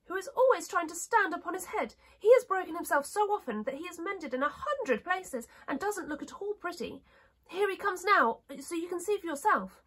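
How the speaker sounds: high-pitched princess, explaining; china princess. Acted. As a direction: tell the gossip in a high little voice.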